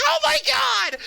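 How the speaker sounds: very high, hammed-up shriek; mock panicked scream. Five alligators come out of nowhere, mock panicked scream. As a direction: scream in pretend terror. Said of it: "and it's like".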